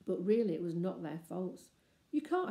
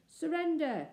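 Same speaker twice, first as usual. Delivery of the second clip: distant shout; 9 semitones higher, 4 dB louder than usual. A narrator's reading. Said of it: "the shouts of".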